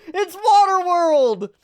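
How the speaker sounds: very high, loud, stretched-out exclamation; excited shout. Real, not acted. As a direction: crow it slowly at the top of your voice. Delighted realization while reading.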